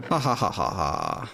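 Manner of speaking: flat, mocking ha ha ha; sarcastic fake laugh. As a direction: laugh without meaning it.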